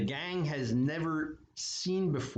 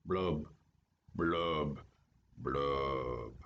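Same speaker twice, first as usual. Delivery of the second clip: sinking blub blub blub, each one lower; sad fish blubs; 9 semitones lower, much slower than usual. Acted. Gloomy fish sighing blub after his refrain. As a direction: let the blubs sink away sadly.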